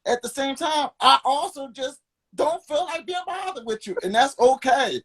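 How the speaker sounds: worked-up rant. Real, not acted. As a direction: push back hard at the friends who say you changed.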